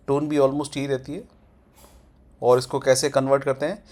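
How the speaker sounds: ordinary talk, nothing marked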